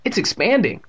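high-pitched exclamation of wonder; astonished, voice leaping up; amazed. A narrator's reading. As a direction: react in surprise to something strange. Amazed at the growing goo.

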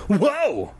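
high, drawn "whoa"; mild high-pitched yelp of surprise; startled cry. A narrator's reading. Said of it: "I cried out as my closet door swung open".

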